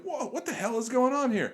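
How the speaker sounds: high-pitched baffled protest; retold bewilderment.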